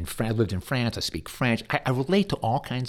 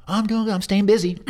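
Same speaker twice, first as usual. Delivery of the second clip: much higher, louder forced brightness; mock cheerful; 11 semitones higher, 6 dB louder, faster than usual. Bereaved person on the phone insisting they're fine, mock-cheerful. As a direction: put on a too-bright cheery front.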